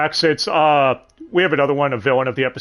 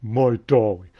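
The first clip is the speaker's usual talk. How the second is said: Haunting Ground quote; 4 semitones lower than usual. Retold or imitated. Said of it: "make the Haunting Ground joke".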